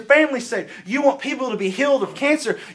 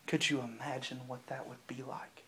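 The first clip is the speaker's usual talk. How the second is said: hushed whisper; 10 semitones lower, 19 dB quieter than usual. Real, not acted. Preacher to the youth, imagining God's friendship, in a whisper. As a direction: whisper it, much softer and lower. Preacher drops to awed hush.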